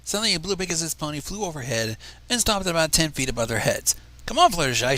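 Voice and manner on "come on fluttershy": louder, hurrying her along; impatient shout. A narrator's reading. Blue Pegasus hurries Fluttershy from overhead, shouting impatiently. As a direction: call out, fed up with waiting. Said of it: "she shouted impatiently".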